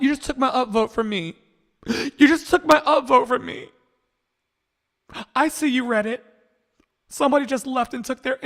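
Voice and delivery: high, loud melodramatic wail; mock distress. Confronting Reddit over a stolen upvote, hammed-up distress. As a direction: play wounded and aghast, pitched right up.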